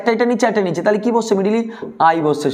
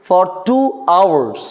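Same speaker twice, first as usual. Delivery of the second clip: slow dictation; much slower than usual. Shown as a demonstration. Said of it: "আমি বলতেই পারি দু ঘন্টা পড়ি".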